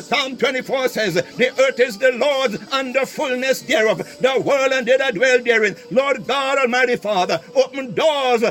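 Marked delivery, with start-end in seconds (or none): none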